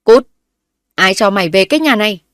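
loud, furious father ordering her out; gruff furious yell at his returning daughter; angry gruff shout. A narrator's reading. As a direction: bark it out like an enraged old man. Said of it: "giọng nói ồm ồm tức giận ấy vang lên".

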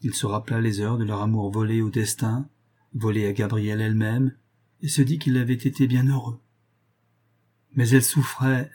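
ordinary delivery

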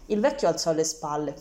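ordinary delivery

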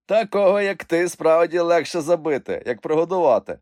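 high, loud jeer; teasing mockery. A narrator's reading. Uncle mocking Ivan's big appetite, teasing. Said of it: "глузував Вуйко".

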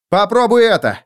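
high, loud call. Acted. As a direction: toss the words with the keys. Felix throws keys, calls out.

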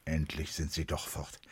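ordinary delivery

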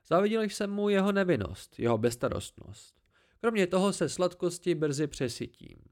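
haughty tree creature. Acted. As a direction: talk down to the boy from the branches, pleased with yourself.

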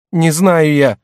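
high, loud cry of protest; short, heated outburst; desperate denial. Acted. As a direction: throw the denial back at full voice. Servant cries denial back at master.

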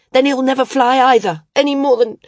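high and loud, about to crack; edge of hysteria. Acted. Maris threatening to break the wings, high and loud on the edge of hysteria. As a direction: near breaking, voice pushed up. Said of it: "riding the edge of hysteria".

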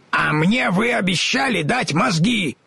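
high, eager scarecrow. A narrator's reading. Scarecrow reminds the wizard of his brains, eager. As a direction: blurt it out like a straw man.

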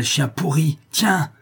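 rising rage, hurl it at the corpse; furious insult. Acted. Manon insults her friend's killer. (Manon insulte le chef, cri de rage aigu)

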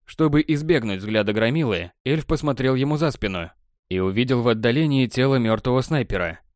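ordinary delivery

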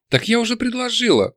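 high-pitched indignant protest. A narrator's reading. Demon insisting she already made her offer, indignant. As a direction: protest as if insulted, voice lifted high. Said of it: "возмутилась она".

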